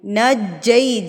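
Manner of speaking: نجی drawn out very slowly, the jeem hit hard and doubled; strong doubled jeem. Shown as a demonstration. Teacher models نجی with a hard doubled jeem. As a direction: press the shaddah on the jeem in نجی. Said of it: "جیم کو مضبوطی سے پڑھنا ہے".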